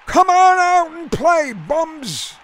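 high, loud heckle from the stands; crowd yell. A narrator's reading. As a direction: yell it at the team like a rowdy fan. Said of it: "the crowd yelled".

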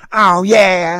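loud, high retort; defiant dog owner. A narrator's reading. Dog owner answers the pedigree rule, defiant. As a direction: challenge him, high and loud.

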